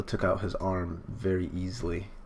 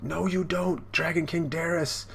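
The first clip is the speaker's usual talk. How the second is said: high-pitched mock yell at an enemy; hammed-up anime defiance; defiant battle cry; 9 semitones higher than usual. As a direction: call out a defiant challenge, much higher. Acting out character's defiant cry in fight.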